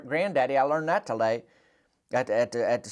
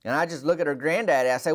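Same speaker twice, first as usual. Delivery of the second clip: usual speaking voice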